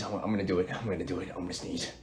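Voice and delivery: fading from soft to a whisper; holding a sneeze. Real, not acted. Streamer fighting a sneeze, sinking to a whisper. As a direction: let it fade to a breathless whisper. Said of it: "I have to sneeze".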